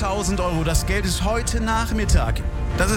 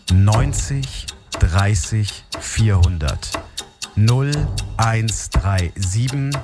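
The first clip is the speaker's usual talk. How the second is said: urgent hotline readout; 9 semitones lower, slower than usual. Acted. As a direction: hammer the call-in number home, slowly. Final urgent number readout.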